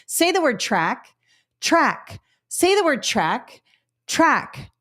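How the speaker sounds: sing-song teacher prompt; teacher demo. Shown as a demonstration. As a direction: model the drill like a classroom teacher. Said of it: "Here's a phonological awareness activity".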